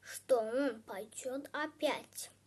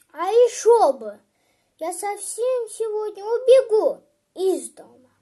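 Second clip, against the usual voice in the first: put-on loud, higher-pitched childish outburst; upset, angry boy; 5 semitones higher, 10 dB louder than usual. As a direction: snap back like an aggrieved child. Sulky boy snaps at old man.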